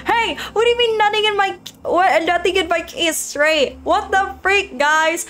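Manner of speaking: high, fast, offended protest; a put-upon game girl huffing at chat; mock indignant [name]. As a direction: squeak out a playful protest.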